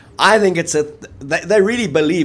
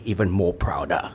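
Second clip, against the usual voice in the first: whispered aside; 7 semitones lower, 6 dB quieter than usual. Acted. Aside while wearing French top.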